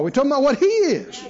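ordinary talk, nothing marked

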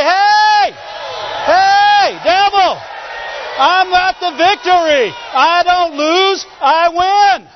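defiant full-voiced battle cry; victorious shout. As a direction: shout it in triumph, drawn out. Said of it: "you just need to shout".

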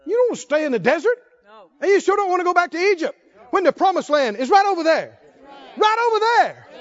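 rally the congregation toward the promise; forceful preaching. Real, not acted.

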